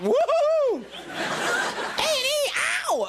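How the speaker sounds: over-the-top cheering fan squeal; wild fan whoop. Retold or imitated. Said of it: "but not".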